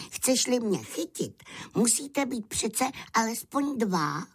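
high-pitched lamb voice, reasoning cockily; clever little lamb. Acted. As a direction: lecture a silly wolf in a high little voice. Lamb lectures the wolf. (chytrý beránek poučuje vysokým hláskem)

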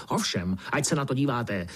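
high-pitched, ranting indignation; worked-up squawky fury; raging beetle. A narrator's reading. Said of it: "černý brouk se vztekal dál".

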